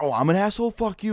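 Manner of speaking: high-pitched offended comeback; mock indignant. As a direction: imitate an affronted guy hitting back, voice jumping up. Acted angry comeback in retold fight.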